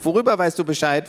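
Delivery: put-on high little girl voice; curious little girl. Little sister barging in, high and curious. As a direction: ask like a nosy six-year-old. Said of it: "fragte Tiffany".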